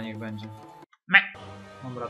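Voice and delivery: ordinary talk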